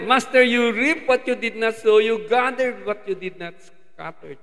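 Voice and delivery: loud accusing quote. Retold or imitated. Servant's words to master quoted.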